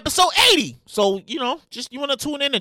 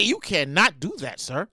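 normal talking voice

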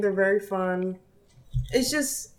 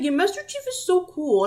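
higher-pitched gushing kid imitation; excited kindergartner; 9 semitones higher than usual. Retells kindergartner gushing over character.

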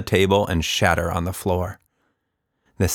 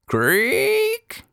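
plain reading voice

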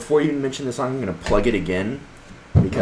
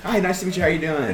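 open and friendly; 8 semitones higher than usual. Pretend. Playing gracious celebrity meeting fan.

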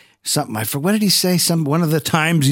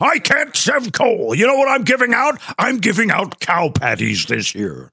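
Santa voice; 5 semitones higher than usual. Acted.

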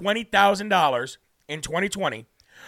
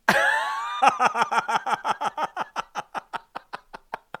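long high-pitched burst of real laughter; big laugh. Real, not acted. Host cracking up at a viewer's joke comment, high laughter. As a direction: crack up helplessly, rising high.

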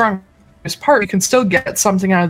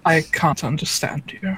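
put-on low, softer British accent; mock British accent, pitched down; 5 semitones lower, 6 dB quieter than usual. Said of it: "he had the British accent".

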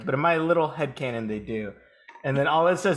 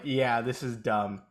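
bored deadpan; 7 semitones lower, 6 dB quieter, slower than usual. Retold or imitated.

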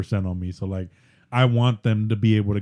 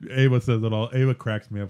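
ordinary delivery

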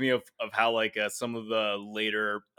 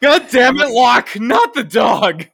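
put-on much higher and louder, slow drunken yelling; drunken shout; 11 semitones higher, 14 dB louder, slower than usual. Co-host re-enacts yelling drunkenly at the movie, in a loud shout. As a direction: yell at the screen like a drunk fan.

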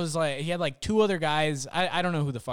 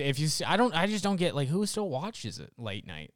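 normal talking voice

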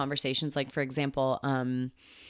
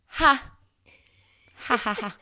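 high bursts of laughter; real laughter; 11 semitones higher, 5 dB louder than usual. Real, not acted. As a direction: laugh it off, amused at yourself. Laughing at own worry.

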